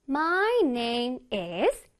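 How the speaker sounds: "My name is" stretched note by note; very slow, melodic lead-in on "My name is"; piano-like intonation. Shown as a demonstration. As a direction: hold each word of "My name is" like a piano note. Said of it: "내 몸이 피아노가 된다고 생각해야 돼".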